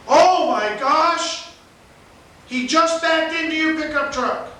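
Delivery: high, loud, drawn-out mock shock; secretary's alarm. Secretary exclaims to the man in Scotty's about his truck, mock alarm. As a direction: imitate a shocked cry, stretched out and high. Retelling the secretary seeing the crash.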